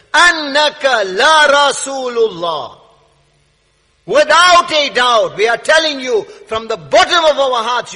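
loud, high-pitched sermon delivery; forceful preaching. Real, not acted. Preacher expounding the hypocrites' testimony to the congregation, forceful and loud. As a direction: hammer out each point with rising force.

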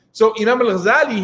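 normal talking voice

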